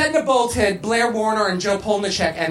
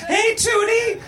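high, over-eager greeting; nervous blurting; 13 semitones higher, slower than usual. A narrator's reading. Willis greets Tootie after the bathroom, nervously blurting. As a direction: blurt it out high, caught off guard. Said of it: "Willis nervously blurted out".

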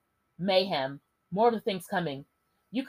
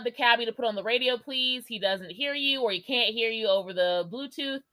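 ordinary delivery

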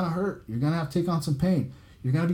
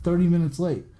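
ordinary talk, nothing marked

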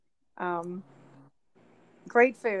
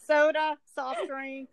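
laughing; 7 semitones higher than usual. Real, not acted. Joking about Coke and soda names.

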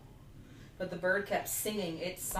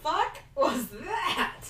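higher, slow stunned disbelief; baffled; 10 semitones higher, 4 dB louder, slower than usual. Retold or imitated. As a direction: drag it out in total bewilderment. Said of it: "I was like".

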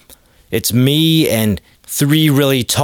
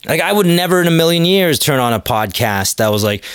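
ordinary delivery

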